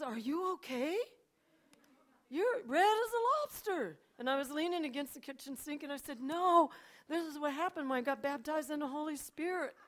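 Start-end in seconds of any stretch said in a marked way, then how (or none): 0.0-4.0 high, loud, incredulous questioning; acted astonishment, pitched high; startled husband. 6.2-9.8 high, loud, emphatic explanation; acted excitement, starting on a loud high burst; excited reply. Acts out her excited reply to husband.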